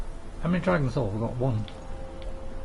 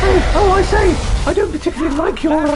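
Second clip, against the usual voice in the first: posh lady voice; 7 semitones higher, 5 dB louder than usual. Acted. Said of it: "The Lady Eowyn".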